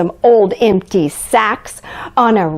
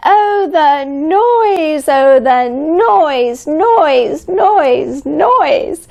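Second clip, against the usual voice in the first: higher, drawn-out sneer at each noise; disgusted at the noise; 7 semitones higher, much slower than usual. A narrator's reading. Grinch groaning about the Who noise, disgusted. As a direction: spit out every noise like it hurts.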